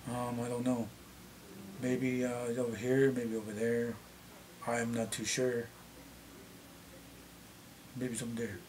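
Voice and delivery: low, unhurried, gravelly-free musing with long pauses; drawn-out elder talk, weighing each word; slow deep native elder voice. Shown as a demonstration. Showing viewers the deep voice he could use, slow and low. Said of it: "I could talk like that".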